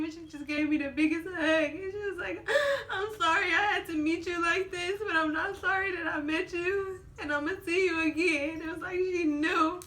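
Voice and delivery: high, loud tearful voice; crying. Real, not acted. Mother recalling the nurse's parting hug, crying. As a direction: pour it out through real tears.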